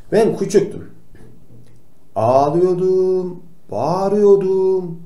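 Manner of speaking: put-on high, slow small-kid voice; little-child voice. Doctor telling kids he cried as a child, high and slow. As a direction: drag it out high, like a little boy.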